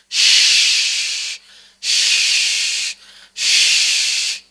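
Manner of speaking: Shh, shh, shh with strong breath spread in the mouth; spread shin hiss. Shown as a demonstration. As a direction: hiss Shh with a wide rush of air. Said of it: "mengembuskan napas yang deras".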